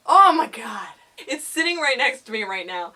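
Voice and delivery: ordinary talk, nothing marked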